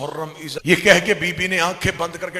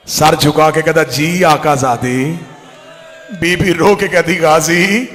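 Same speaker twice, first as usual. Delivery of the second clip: loud, impassioned majlis recitation; full-force preaching; 12 dB louder than usual. Acted. Zakir telling mourners of Abbas and his mother, impassioned loud preaching. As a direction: pour the grief out to the crowd at full volume.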